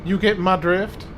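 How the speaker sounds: southern belle. Acted. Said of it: "commit to doing that voice for him".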